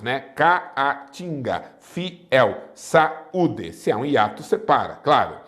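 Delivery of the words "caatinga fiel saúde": "Caatinga, fiel, saúde" broken into syllables, pausing between the vowels; split hiatus vowels. Shown as a demonstration. Teacher showing how hiatus vowels separate, syllable by syllable. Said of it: "Separam-se as vogais dos hiatos".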